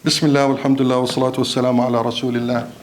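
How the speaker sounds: low, rapid Arabic liturgical recitation; hushed-down formulaic close and reopening in Arabic; recited sermon formula. Acted. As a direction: run through the set formula low and evenly. Recites closing and opening sermon formulas.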